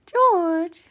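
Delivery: high, drawn-out name call; calling the pet. Real, not acted. Owner calling cat George, high coo. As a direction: sing out the pet's name.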